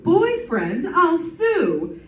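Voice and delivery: put-on indignant squawk at being called his girlfriend; indignant outburst. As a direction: bristle at the word boyfriend and threaten to sue.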